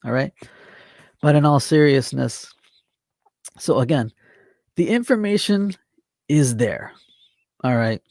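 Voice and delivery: ordinary delivery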